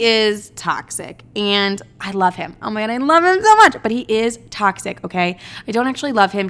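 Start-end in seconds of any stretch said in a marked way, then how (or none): 2.6-3.8 high, rushed, loud gush. Acted. Telling friends about a toxic twin flame, gushing. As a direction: blurt it out like a smitten fangirl.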